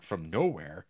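ordinary talk, nothing marked

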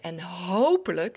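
weighted hopelijk, drawn out with emphasis; stress on hopelijk. Real, not acted. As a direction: lean hard on the word hopelijk. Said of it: "met de nadruk op hopelijk".

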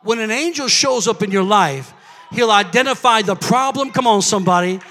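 loud, driving preacher's call; preaching at full force. Real, not acted. Preacher shouting to the church about angels. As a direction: pound the point home to the congregation.

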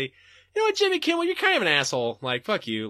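high, sing-song fake-nice voice; mock polite insult. Telling off Jimmy Kimmel, high mock-sweet voice. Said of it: "I'm just gonna say".

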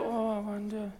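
soft, unsure mock prayer; timid mock prayer. Mocking a hesitant believer praying, soft and doubtful. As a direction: sigh it out, doubtful and small. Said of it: "You don't have to".

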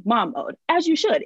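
ordinary talk, nothing marked

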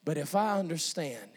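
low, soft, intimate preaching; quiet, reflective sermon voice; hushed preaching. Acted. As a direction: drop right down and confide it to the congregation.

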